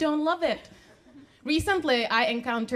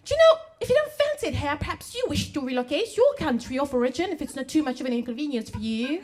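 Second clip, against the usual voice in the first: high-starting, hammed-up British accent; mock British politeness, dropping from a high start; horrible British accent; 3 semitones higher than usual. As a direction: do a badly faked, overpolite British accent. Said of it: "I do horrible British accent".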